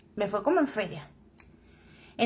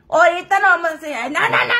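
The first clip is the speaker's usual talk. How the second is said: higher, protesting voice for the friend; mock the friend's quick refusal; friend's voice, raised and protesting; imitating her friend; 4 semitones higher, 4 dB louder than usual. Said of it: "me dice mi amiga".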